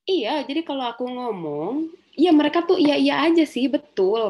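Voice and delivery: put-on high, loud frustrated teen complaint; upset teenager. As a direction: vent like a hurt teenager. Teen quoted complaining about parents.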